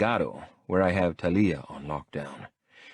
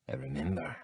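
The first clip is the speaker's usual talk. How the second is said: softer, cool and controlled; coolly; 3 semitones lower, 9 dB quieter than usual. A narrator's reading. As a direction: answer coolly without giving an inch. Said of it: "I answer coolly".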